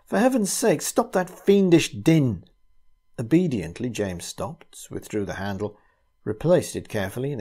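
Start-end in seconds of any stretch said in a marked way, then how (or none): 0.0-2.5 shouting. A narrator's reading. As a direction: yell it over the noise. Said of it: "shouted out".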